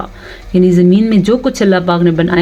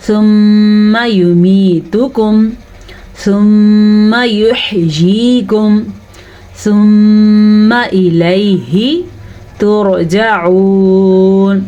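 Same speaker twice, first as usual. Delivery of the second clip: very slow recitation with long nasal holds; measured Quranic recitation, vowels drawn out; tajweed recitation; much slower than usual. Shown as a demonstration. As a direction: hold each doubled meem in a hum. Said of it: "یہ تو ہم نے پڑھا تجوید سے".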